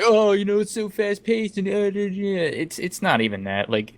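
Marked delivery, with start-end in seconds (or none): 0.0-2.3 mocking. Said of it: "it's easy to be like".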